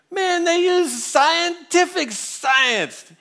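put-on high, slow, bellowing drunk voice; drunk guy shouting. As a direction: bark it out like a drunk who thinks he has won. Drunk man's final answer.

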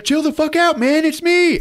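high, loud desperate shout; frantic yell. Retold or imitated. Sarah yelling at the boy shooting at her, frantic yell. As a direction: scream to make him stop.

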